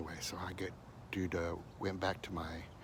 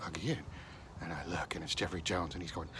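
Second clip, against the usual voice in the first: ordinary delivery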